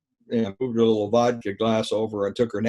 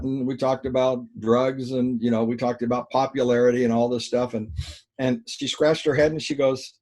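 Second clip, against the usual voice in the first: ordinary talk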